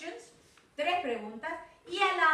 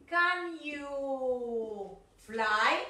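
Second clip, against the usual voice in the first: very slow drill question with a rising end; deliberate classroom modelling; very slow teacher model; much slower than usual. Shown as a demonstration. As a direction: stretch every word for the class.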